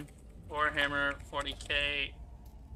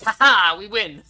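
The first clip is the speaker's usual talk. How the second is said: loud, higher gloating cheer; mock triumph; 4 semitones higher, 11 dB louder than usual. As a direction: crow it like a hammy villain. Voices Necrons waking up triumphant.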